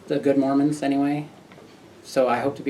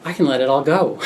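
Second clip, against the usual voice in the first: relieved realization; 4 semitones higher, 6 dB louder than usual. Retold or imitated. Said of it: "And I was like".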